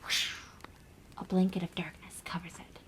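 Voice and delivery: hushed, mostly whispered ghost story; spooky campfire story. Acted. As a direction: draw the listeners in with a secretive hush.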